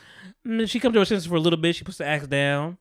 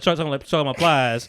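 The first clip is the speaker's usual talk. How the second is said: usual speaking voice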